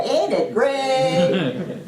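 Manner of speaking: loud, high, long-stretched Southern drawl. Retold or imitated. Workshop leader teaching her catchphrase, big Southern drawl. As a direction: draw out each word in a Southern drawl. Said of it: "said it with this southern drawl".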